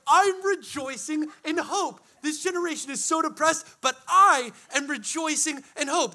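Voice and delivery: high, stretched-out declaration of joy; hammed-up rejoicing. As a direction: proclaim it high, savoring each word. Acts out joyful declaration.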